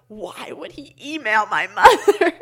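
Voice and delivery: loud, slow, laughing through the mock outrage; laughing disbelief. Teen retelling his outrage at a favorite teacher, slow loud laughing disbelief. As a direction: let the laugh break through the question. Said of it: "and I was like".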